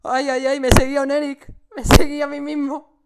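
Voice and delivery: high loud shaken exclaiming; breathless excitement. Real, not acted. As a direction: still rattled after the scare. After the chase, still worked up.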